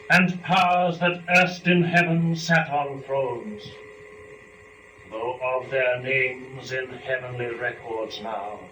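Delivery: slow, grand recitation of epic verse; epic poem reading. Acted. Reader performs Book One of Paradise Lost for students, slow and grand. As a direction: declaim the lines with weight and ceremony.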